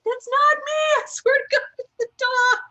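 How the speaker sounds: very high, loud squeaky denial; hammed-up high-pitched protest; mock protest.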